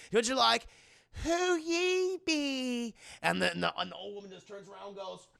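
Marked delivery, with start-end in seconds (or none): 1.2-2.9 high, slow, drawn-out question; put-on child voice. The twins question the old woman, in a high drawn-out voice. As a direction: stretch each word in falsetto.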